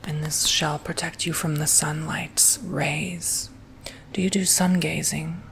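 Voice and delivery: ordinary talk, nothing marked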